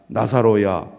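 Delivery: calling out a name loudly; a sudden loud summons to the tomb. Retold or imitated. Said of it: "큰 소리로". (무덤을 향해 크게 부르는 외침)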